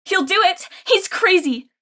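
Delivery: loud, high frantic shout. Acted. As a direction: yell it at the grain piles. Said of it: "she shouted at the grain piles".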